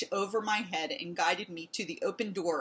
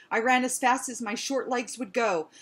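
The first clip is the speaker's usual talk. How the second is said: ordinary delivery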